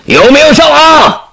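very high, strained shouted question; commander's bellow. Acted. Command room asking troops about casualties, high urgent bellow. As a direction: yell it across the battlefield. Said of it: "指挥室又一声吼".

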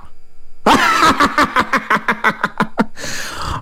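long rattling stage laugh, high at first; acted laugh. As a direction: laugh it out in a long run of quick pulses. Zhang Xin reacts to the news.

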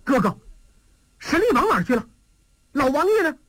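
urgently asking where the prince went; shocked man asking his brother where the old prince is; startled. Acted. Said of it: "他大吃一惊".

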